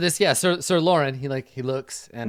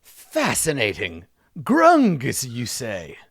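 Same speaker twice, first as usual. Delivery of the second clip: fascinated knight; 6 dB louder, much slower than usual. Acted. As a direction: savor a strange new word grandly. Pompous knight voiced by game master.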